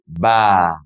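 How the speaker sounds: "va" with both vowels merged into one long A. Shown as a demonstration. Teacher showing va plus a together, long A. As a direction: stretch "va" as one syllable, vaa. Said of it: "like a long A".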